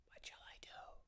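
helpless, voiceless little whisper. Acted. Little rabbit asks a squirrel for help, whispering. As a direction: whisper it with no voice at all. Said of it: "he whispered to a squirrel".

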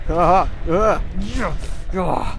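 loud, high gloating cheer; big victorious battle cries; triumphant yelling. Pretend. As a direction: crow over the kill at full voice. Celebrating kills in game fight.

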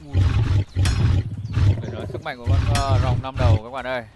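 loud excited commentary; live play-by-play. Acted. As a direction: call the monster fight loudly.

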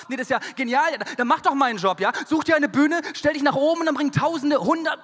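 loud, high, heated retort; angry comeback to the ex's advice; indignant rant. Retold or imitated. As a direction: rant back at her, getting worked up. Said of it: "Und dann meinte ich so".

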